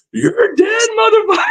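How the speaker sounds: very high, strained threat; mock battle cry. Charging at two criminals to double down, wild threat.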